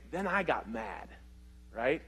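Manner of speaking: normal talking voice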